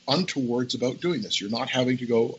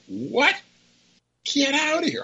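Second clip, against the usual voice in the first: retold disbelief. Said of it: "they're like".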